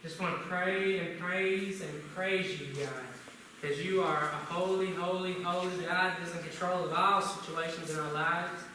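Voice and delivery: low, hushed opening prayer to God. Acted. Pastor leading the church in prayer, a reverent low prayer.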